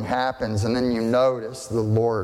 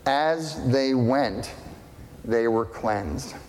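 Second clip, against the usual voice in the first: slowed for emphasis; slower than usual. Shown as a demonstration. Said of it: "Here's really important words".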